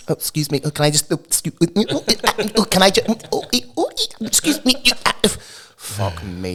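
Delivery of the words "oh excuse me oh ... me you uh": high, strained little oh's and excuse me's; pushing past people in a panic, rising higher; mock desperate squeaks. Desperate for the toilet, squeaking excuse me to passengers.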